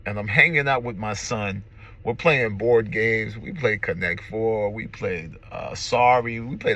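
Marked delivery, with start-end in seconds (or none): none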